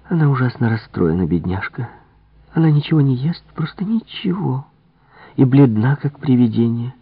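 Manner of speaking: pitying servant confiding about her mistress; sympathetic maid. Acted. Maid tells Poirot about distraught madame, sympathetically.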